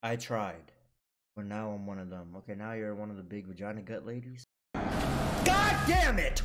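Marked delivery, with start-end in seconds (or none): 4.7-6.4 high, loud, drawn-out yell; frustrated yell. Real, not acted. Player losing the game, long loud frustrated yell. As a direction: stretch out an angry cry of defeat.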